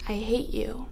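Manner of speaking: softly, with long loaded pauses; hushed calm before an explosion; quiet, restrained hatred. Acted. Woman telling her husband she hates him, quietly menacing.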